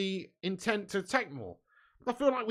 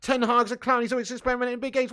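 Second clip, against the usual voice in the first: louder, higher, fast mocking echo; sneering louder parroting of a comment; scornful re-read; 4 semitones higher, 6 dB louder, faster than usual. Shown as a demonstration. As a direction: throw his words back at him in disbelief. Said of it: "What are you talking about?".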